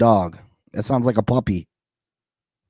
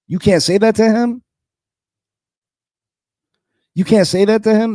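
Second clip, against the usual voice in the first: loud, high incredulous question; can't believe anyone would object; 7 semitones higher, 5 dB louder than usual. Real, not acted. Incredulous at coach criticism.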